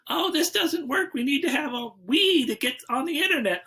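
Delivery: high-pitched mock complaint; imitated complaining partner. Retelling partner's gripe about the streaming stick, high mock complaint. Voicing partner in a retold dispute.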